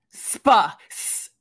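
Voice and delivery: loud strained "spa"; exasperated stuttered "spa"; frustrated attempts. Real, not acted. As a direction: fight to get "spa" out. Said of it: "Less anger".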